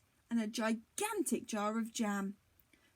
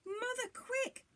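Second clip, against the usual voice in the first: urgent shout; 11 semitones higher than usual. A narrator's reading. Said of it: "Jack shouted".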